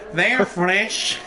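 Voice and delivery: giggling through the words; laughing. Real, not acted. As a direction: laugh while saying it. Laughing repeat of fresh joke.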